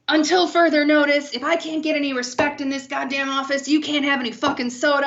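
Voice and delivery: imitating an angry boss's announcement; irate boss. Irate boss's email acted out.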